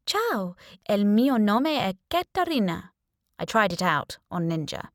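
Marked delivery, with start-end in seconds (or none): 0.1-2.9 put-on first try at an Italian accent, a bit higher; testing an Italian accent in the mirror; trial Italian accent. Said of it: "I had to choose a nationality, a language, an accent".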